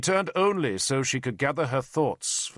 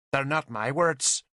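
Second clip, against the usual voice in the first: insolent; 2 semitones higher than usual. Acted. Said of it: "she said insolently".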